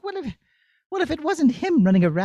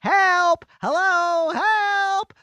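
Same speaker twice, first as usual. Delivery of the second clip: calling for help; 5 semitones higher, much slower than usual. Acted. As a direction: call out for rescue from far off. Princess in danger calling out.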